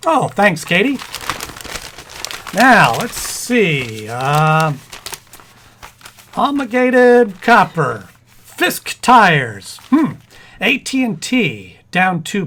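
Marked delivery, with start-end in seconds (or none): 2.5-4.7 slow, drawn-out and louder, scanning the page; absorbed reading. Acted. Husband poring over the morning paper, slow and loud. 6.3-9.8 loud, drawn-out stock listings; reading aloud. Acted. Husband reading stock listings to himself, slow and loud. Reads stock names off the paper.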